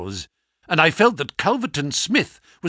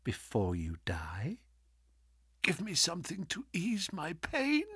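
weak dying whisper; 2 semitones lower, 15 dB quieter than usual. Acted. Dying Holmes pleading weakly.

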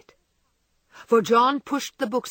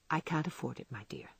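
much softer, fading to nothing; quiet resignation; 6 semitones lower, 11 dB quieter than usual. A narrator's reading. John explaining the cancelled coat to Meg, very quietly. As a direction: say it simply and let it fade away.

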